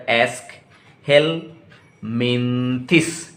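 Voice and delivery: एस्कहेलमिंथिस spoken very slowly, syllable by syllable. Shown as a demonstration. Teacher shows how it is pronounced, slow syllables. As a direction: stretch out एस्कहेलमिंथिस. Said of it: "pronunciation कैसे होगा".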